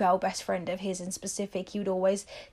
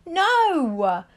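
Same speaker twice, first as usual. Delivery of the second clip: emphatic no; 8 semitones higher, 5 dB louder than usual. Real, not acted. Rejecting the excuse.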